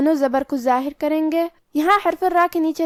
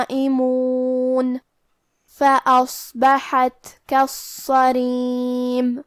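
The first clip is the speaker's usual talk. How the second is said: Quran recitation; much slower than usual. Acted. Teacher reciting the next verse, slow chant.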